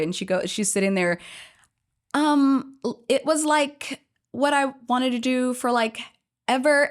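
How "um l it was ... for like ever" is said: higher-pitched mock Valley girl. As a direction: keep up a put-on Valley girl voice. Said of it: "trying to maintain this voice".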